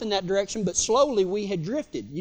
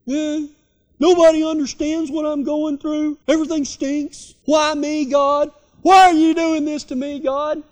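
higher, whining self-pity; whiny pity party; 4 semitones higher, 4 dB louder than usual. Acted. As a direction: moan like nobody understands you. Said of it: "walking around feeling".

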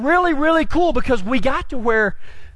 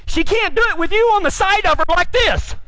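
exasperated correction; 7 semitones higher than usual. Retold or imitated. Instructor's exasperated correction retold.

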